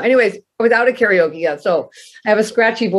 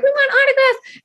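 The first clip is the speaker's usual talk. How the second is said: high squealing plea for autographs, much higher; squealing fan. Retold or imitated. Girlfriend begging guitarist for autographs, squealing high. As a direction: squeal it like a starstruck teen. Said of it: "my girlfriend's like".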